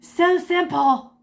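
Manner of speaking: slow, high, smug "so simple"; smug brain voice. Acted. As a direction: drawl it like a know-it-all brain. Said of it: "your brain's like".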